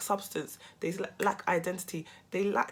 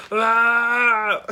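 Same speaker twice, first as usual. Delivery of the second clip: angry grunt; 3 semitones higher, 9 dB louder than usual. Retold or imitated. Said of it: "He was like".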